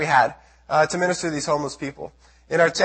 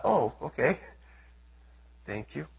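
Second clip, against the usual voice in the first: deflated thanks; 4 semitones lower, 9 dB quieter than usual. Retold or imitated. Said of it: "I thought".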